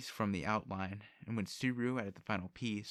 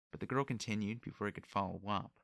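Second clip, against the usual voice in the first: usual speaking voice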